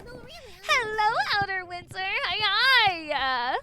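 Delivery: high, loud singled-out hello; excited greeting. Real, not acted. Streamer greeting a viewer, excited high call. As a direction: call out a big welcome.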